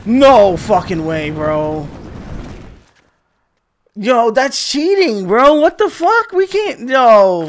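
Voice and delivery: high, loud indignant shouting; outraged yelling. Real, not acted. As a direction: yell it like you've been robbed. Outraged at cheating game mechanic.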